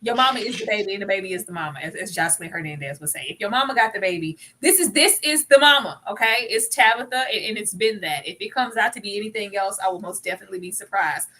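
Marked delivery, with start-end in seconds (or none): none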